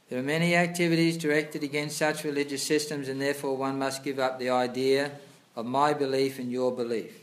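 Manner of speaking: loud, formal reading of scripture; reading aloud. Acted. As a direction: read the passage out like a sermon. Reads purport aloud louder.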